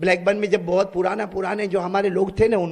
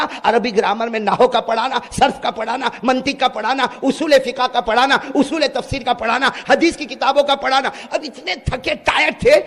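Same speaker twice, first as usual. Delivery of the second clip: ordinary delivery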